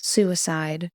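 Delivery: ordinary delivery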